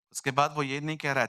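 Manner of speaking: normal talking voice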